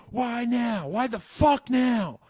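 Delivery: high, slow shouted outburst; Tony Soprano shout. Retold or imitated. Tony shouting at his therapist, high slow shout. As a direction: yell it at the shrink. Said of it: "Tony was shouting at his shrink".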